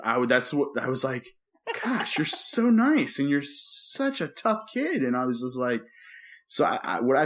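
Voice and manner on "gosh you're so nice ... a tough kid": high, slow, doting praise; gushing. Retold or imitated. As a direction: gush over him like a proud parent. Father gushing over his son.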